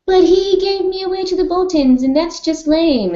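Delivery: put-on high, whiny teen voice; mock whiny Sansa. High whiny voice, Sansa refusing Peter's help to the council. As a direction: whine it like a sulky girl. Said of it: "And she'd have been like".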